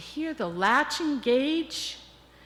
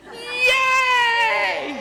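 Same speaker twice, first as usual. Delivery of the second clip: high, loud, joyful yay with hands up; exuberant cheer; 12 semitones higher, 7 dB louder than usual. Shown as a demonstration. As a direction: cheer it out like you won. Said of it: "followed by an exuberant hands up".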